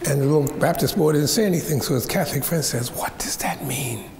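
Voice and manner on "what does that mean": hushed, breathless church whisper; a kid sneaking a question during the sermon. Retold or imitated.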